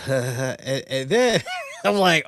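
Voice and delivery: usual speaking voice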